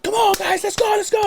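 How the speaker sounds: high, excited mock yell at the team; fan screaming. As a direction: cheer them on like a frantic fan. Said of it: "It's just, like, screaming".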